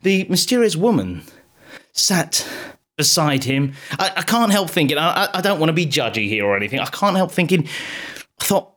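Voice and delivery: ordinary delivery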